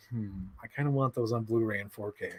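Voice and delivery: soft hushed musing to oneself; mock quiet craving; tempted inner voice. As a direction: murmur it like a guilty temptation. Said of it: "I'm like".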